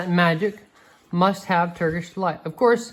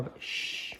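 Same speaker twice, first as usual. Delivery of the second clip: whispered shh; soft warning whisper; whispered hush; 14 dB quieter than usual. A narrator's reading. Missus Beaver hushes them.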